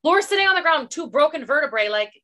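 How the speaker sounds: normal talking voice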